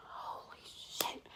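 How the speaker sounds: slow, breathy holy shit whisper; stunned whisper. Retold or imitated. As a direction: whisper it slowly as it sinks in. Realizing wife followed her.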